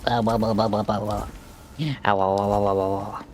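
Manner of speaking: silly otter chatter noises; playful babbling otter sounds; otter chirps. Pretend. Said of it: "with my sexy otter noises".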